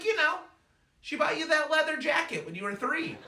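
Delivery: high-pitched mom voice; insistent mom impression. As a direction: jog your son's memory as mom. Mom explains who Shirley is.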